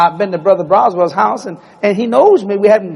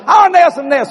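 put-on high, loud kid begging; little Jesse begging his big brother, high and loud; whiny little kid; 10 semitones higher, 4 dB louder than usual. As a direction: beg like a six-year-old. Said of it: "I said".

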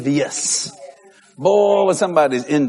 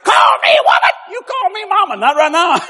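mama scolding; 14 semitones higher than usual. Retold or imitated.

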